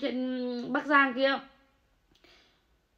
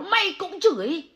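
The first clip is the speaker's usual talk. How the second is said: angry; 7 semitones higher, 4 dB louder than usual. Real, not acted. Repeated accusation, climaxing.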